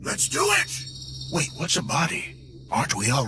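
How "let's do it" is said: much higher, louder burst of eagerness; eager cry. Acted. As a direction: leap at the idea with glee. Brain eager to get body.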